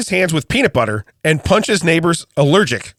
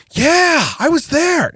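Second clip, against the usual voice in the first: mock dumb fan; 10 semitones higher, much slower than usual. Mock crowd member.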